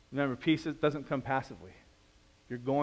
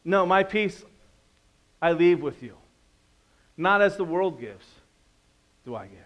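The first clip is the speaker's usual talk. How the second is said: louder, firm quoting of the real verse; emphatic correction; 3 semitones higher, 7 dB louder than usual. Shown as a demonstration. Preacher correcting the congregation with the true verse, firm and loud. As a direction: set the record straight, loud then dropping. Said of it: "Is that what the Bible says?".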